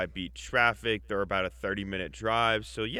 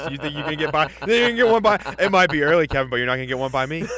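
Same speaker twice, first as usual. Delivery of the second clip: louder, higher mock accusation; playful callout; 4 semitones higher, 8 dB louder, faster than usual. Real, not acted. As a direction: catch him out in mock outrage. Playful calling out.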